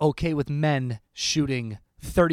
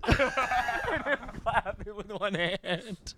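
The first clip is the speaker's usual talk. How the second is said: laughing; 9 semitones higher, much slower than usual. Pretend. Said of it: "It'll be like".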